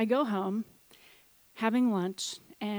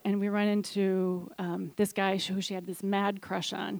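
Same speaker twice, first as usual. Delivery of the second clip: ordinary talk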